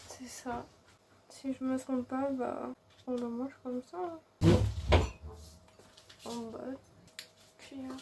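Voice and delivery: tired, swollen-mouth mumble; after wisdom teeth. Real, not acted. Exhausted, in pain after surgery.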